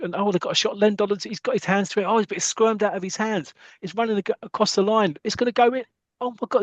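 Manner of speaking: high-pitched, climbing match commentary; tense excited commentary. A narrator's reading. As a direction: sound alarmed as the ball nears the line. Live-style commentary of a near goal.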